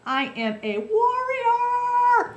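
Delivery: high, drawn-out battle cry on warrior; hammed-up heroic holler, very slow; warrior war cry. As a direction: belt it out like a triumphant warrior. Self pep-talk as mighty warrior.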